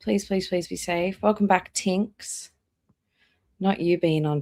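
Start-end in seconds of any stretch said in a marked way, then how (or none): none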